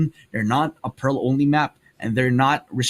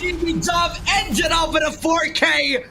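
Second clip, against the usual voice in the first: rising excited esports cast call; play-by-play call; 11 semitones higher, 4 dB louder than usual. Acted. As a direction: build the call to a shouted finish.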